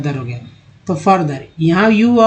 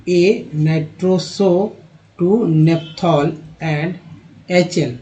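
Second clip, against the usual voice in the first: very slow, word-by-word reading; slow reading aloud; much slower than usual. Shown as a demonstration. Teacher slowly reads the chemistry passage to students, word by word. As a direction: sound out each word of the text slowly.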